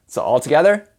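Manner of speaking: ordinary talk, nothing marked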